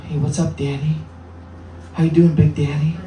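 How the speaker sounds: lightly voiced flirty woman; mock catcall. Comedian mimicking women catcalling him.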